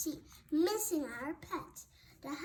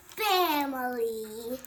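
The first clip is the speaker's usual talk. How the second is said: family drawn out slowly and louder, rising at the end; stretched family; 9 dB louder, much slower than usual. A narrator's reading. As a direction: lean hard on family, stretch it out. Story climax, key word.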